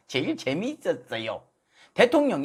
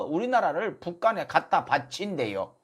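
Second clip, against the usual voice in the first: ordinary talk, nothing marked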